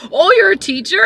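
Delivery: high, loud surprised question; mock surprise. Passenger learning the driver is a teacher, mock surprise. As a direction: mimic his surprise, pitch jumping up.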